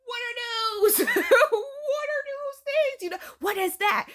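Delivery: high-pitched horrified bafflement, breaking into laughter; hammed-up shock at a bizarre sight; acted disbelief. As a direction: squeal it, incredulous and half laughing. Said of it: "she's in disbelief".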